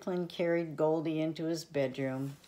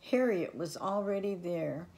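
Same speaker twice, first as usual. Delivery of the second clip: ordinary delivery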